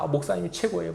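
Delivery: light imitation of flattering praise; gushing congregant. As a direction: gush like an adoring churchgoer. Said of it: "막".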